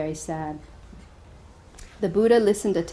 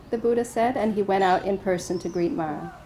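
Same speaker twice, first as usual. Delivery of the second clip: ordinary delivery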